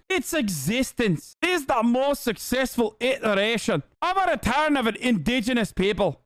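high-pitched mock Scottish accent impression; Eve Fartlow, Scottish accent. Streamer mocking a Zionist columnist's post, high hammed-up Scottish accent. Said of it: "my regular Eve Fartlow impression".

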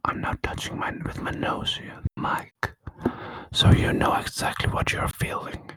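breathy whisper close to the ear; playful whisper to the podcast mic. Pretend. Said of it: "I'm gonna whisper it in your ear".

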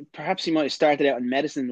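ordinary talk, nothing marked

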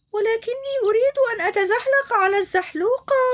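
high, quick little tiger voice. Acted. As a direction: plead like a small child. Little tiger pleads to slide. (نمر صغير يتوسل بصوت عالٍ)

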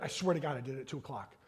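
rattled off fast, low and soft; quick offhand oath. Retold or imitated. Coworker answering a question about a delivery, quick offhand oath. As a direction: imitate a coworker's quick, throwaway swear. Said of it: "just saying that really quick".

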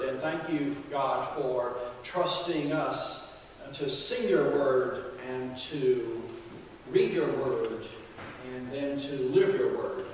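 low, hushed prayer voice. Acted. Pastor opening the sermon in prayer to God, hushed and reverent.

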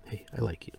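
hushed, whispered come-on; intimate whisper. Shown as a demonstration. Showing listeners the energy of liking someone, whispered. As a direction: whisper it so they feel it. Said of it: "Did you feel that?".

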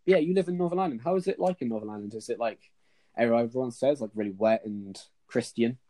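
ordinary talk, nothing marked

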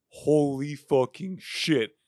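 slow, drawn-out stunned exclamation; hammed-up, drawn-out astonishment; retold shock. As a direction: stretch each word in mock disbelief. Retold shock at man walking in.

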